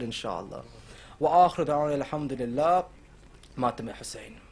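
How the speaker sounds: soft, low closing prayer; hushed supplication after the lament; quiet devotional prayer. Acted.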